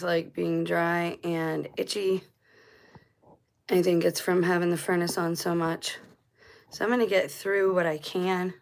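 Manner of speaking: normal talking voice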